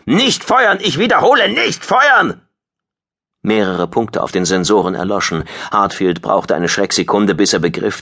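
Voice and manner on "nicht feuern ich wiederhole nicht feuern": high-pitched, frantic order; croaked urgent order. A narrator's reading. Squadron leader stopping his own side firing, frantic shout. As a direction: cry out to halt the shooting. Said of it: "krächzte er".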